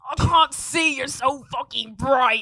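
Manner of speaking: loud, high mock complaint; hammed-up exasperated yelling; Caroline. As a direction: shout it as if blinded, drawing out the end. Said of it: "And then Caroline would be like".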